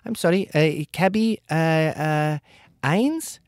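high, hesitant questioning caller; unsure caller. Retold or imitated. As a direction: stumble over the name, rising. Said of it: "they get apologetic".

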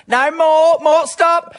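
high-pitched imitated yell; mum shouting. Mum to Dad adjusting the roof aerial, imitated shouting. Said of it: "while Mum's in the doorway shouting".

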